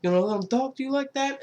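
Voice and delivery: high-pitched goading wiseguy; excited mock needling, pitched well up; Robert De Niro. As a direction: egg him on in a rising, incredulous voice. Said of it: "Robert De Niro's like".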